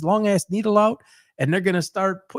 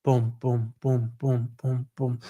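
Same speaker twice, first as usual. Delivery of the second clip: heartbeat imitation; 5 semitones lower, 4 dB quieter, slower than usual. Shown as a demonstration. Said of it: "It's like this".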